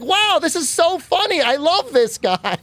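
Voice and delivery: high, slow, delighted with a laugh; imitating a fan laughing along, high and slow; laughing German fan. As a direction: play the fan who loves being roasted. Imitating German fans enjoying roast.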